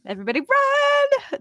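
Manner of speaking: high, loud cry of pretend panic; mock alarm. Co-host warns the chat that Rob is typing, mock alarm. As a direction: sound a joking alarm, voice leaping up.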